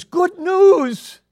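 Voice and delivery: high, loud joyful yell; excited shout. Acted. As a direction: shout it across the camp like an eager boy. Preacher echoes Walter's shout.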